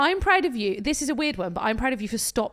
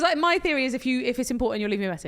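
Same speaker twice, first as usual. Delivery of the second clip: ordinary talk, nothing marked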